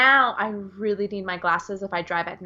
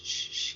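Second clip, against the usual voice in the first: soft unvoiced shh, shh; shushing demo; 11 dB quieter than usual. Shown as a demonstration. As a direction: show the calming shush under your breath. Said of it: "So just shh".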